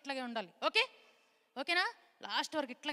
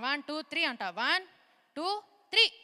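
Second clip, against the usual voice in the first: building countdown; 3 semitones higher, 8 dB louder, slower than usual. Real, not acted. Countdown for kids to shout.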